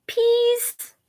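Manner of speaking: put-on high toddler voice; baby voice. Baby Louie begging Mommy, high baby voice. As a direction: lisp it like a tiny baby. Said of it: "baby Louie said".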